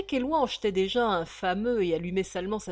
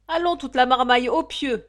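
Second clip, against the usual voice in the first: loud, higher barked command; ordering; 6 semitones higher, 10 dB louder than usual. Acted. Mother Chopin ordering the children to bed, loud and high. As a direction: call the kids off to bed. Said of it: "commanda la mère Chopin".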